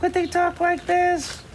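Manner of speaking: very high, slow squeaky voice; high-pitched test voice for a puppet; high character voice. Shown as a demonstration. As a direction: try a tiny cartoon character voice, up high. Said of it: "Think of a voice".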